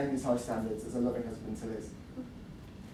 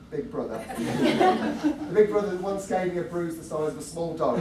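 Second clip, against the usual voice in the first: laughing; 4 semitones higher, 6 dB louder than usual. Real, not acted. Laughing through brother teasing.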